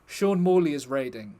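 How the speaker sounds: usual speaking voice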